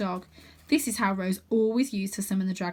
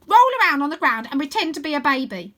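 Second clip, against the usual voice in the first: put-on higher, louder commanding girl voice; bossy girl leader; 6 semitones higher, 9 dB louder than usual. A narrator's reading. Stella orders the boys to act babies, bossy and loud. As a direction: bark the order like a tough gang chief.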